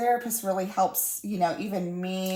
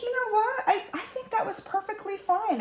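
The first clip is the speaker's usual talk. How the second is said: delighted high squeal; 13 semitones higher than usual. Retold or imitated. Proud mom reacting to daughter.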